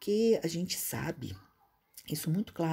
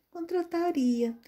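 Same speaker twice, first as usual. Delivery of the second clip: contrataria drawn out slow and pitched much higher; hesitant contrataria; 12 semitones higher, slower than usual. Retold or imitated. The former boss giving the recruiter a hesitant drawn-out contrataria. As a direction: let contrataria hang, slow and up high. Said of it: "Se falar assim".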